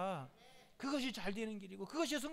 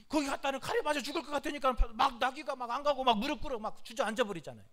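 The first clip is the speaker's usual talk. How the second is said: ordinary talk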